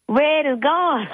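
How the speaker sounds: high, slow cry of dismay; wife finds the empty lizard cage, stretched-out dismay; dismayed discovery. Retold or imitated. Grandma finds the empty cage, slow high dismay.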